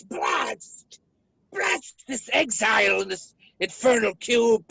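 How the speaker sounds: loud villainous outburst; theatrical cursing. Acted. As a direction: curse your prison with grand fury. Villain curses his imprisonment.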